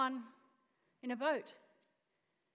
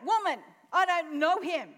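loud, higher indignant denial; emphatic denial; 4 semitones higher, 10 dB louder than usual. Acted. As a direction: deny it forcefully and loudly. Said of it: "he denied it".

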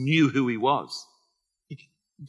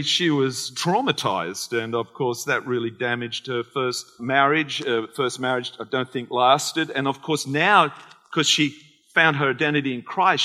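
ordinary delivery

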